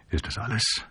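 very soft, drowsy question; hushed question. A narrator's reading. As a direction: ask it quietly in the dark. Sleepy man asks in bed.

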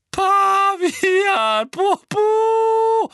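very high, loud, breathless laughing; gasping laughter. Acted. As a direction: gasp out the words between laughs. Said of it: "keuchte sie".

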